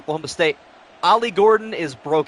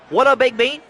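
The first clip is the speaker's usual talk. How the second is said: put-on southern accent; 8 semitones higher, 5 dB louder than usual. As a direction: greet him in a folksy Southern accent. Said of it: "I say it with, like, a southern accent".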